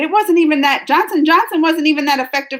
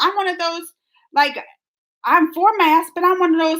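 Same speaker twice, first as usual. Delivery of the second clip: ordinary talk, nothing marked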